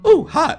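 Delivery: high, quick yelp of pretend pain; mock burn yelp. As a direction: yelp as if scorched. Joke about touching the sun.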